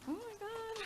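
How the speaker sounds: high, breathy oh my God; overwhelmed surprise. Real, not acted. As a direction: gasp with delight. Opening a heartfelt gift.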